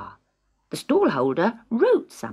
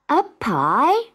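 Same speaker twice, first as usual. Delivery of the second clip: put-on surprised girl; 8 semitones higher, 5 dB louder than usual. A narrator's reading. Marion surprised.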